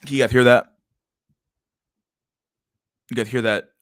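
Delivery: put-on British accent; mock British accent. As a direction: imitate her British accent for the listeners. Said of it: "that British accent she had on".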